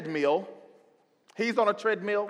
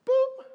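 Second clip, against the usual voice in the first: beep. Retold or imitated. Treadmill button beep.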